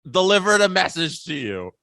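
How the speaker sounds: loud, high teasing scolding; hammed-up indignation, drawing out the words; mock outrage. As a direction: badger a friend in playful disbelief. Mock scolding Steve for not streaming.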